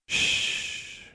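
soft breathy hush; soothing shush. A narrator's reading. Alexandra hushes the crying Anna softly. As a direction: hush her gently as you hold her. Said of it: "Alexandra said, squeezing her".

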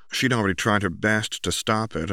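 ordinary delivery, nothing marked

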